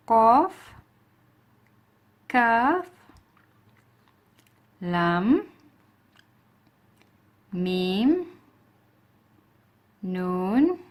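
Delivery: each letter called out slowly, loud and drawn out; clear letter recitation. Shown as a demonstration. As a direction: recite the alphabet for students to repeat. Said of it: "repeat after me".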